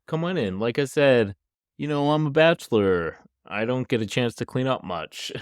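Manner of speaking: imitated friendly host. Dad welcoming daughter's friend inside, lightly imitated friendly host. Retelling the man welcoming her in.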